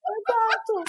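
excited agreement. Real, not acted. Agrees, amused.

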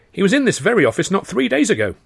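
high eager junior clerk; Handley's voice. A narrator's reading. Junior clerk Handley tells of the visitor, high voice. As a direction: share the gossip eagerly.

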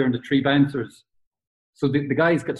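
ordinary delivery

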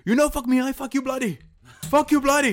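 high, loud hammed-up Italian accent, shouting; mock Italian accent. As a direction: yell it like an angry Italian driver. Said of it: "he has this, like, Italian accent".

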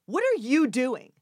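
loud, high, slow challenge; director's angry demand. Retold or imitated. Director halts run-through to challenge actress, angry. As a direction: stop everything with an imitated outburst.

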